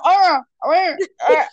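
talking around a stuck spoon; mouth blocked. Retold or imitated. Girl with a spoon stuck in her mouth, muffled. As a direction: try to speak with a spoon jammed in.

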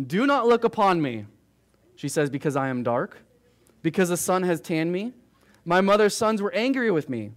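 fervent public reading of the Song of Songs; scripture reading. Acted. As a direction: proclaim the verses to the room. Reads scripture aloud to the group.

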